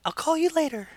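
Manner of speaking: high put-on girl's voice; [name]'s falsetto. Younger sister parting from her boyfriend, in high falsetto. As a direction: say goodbye in falsetto.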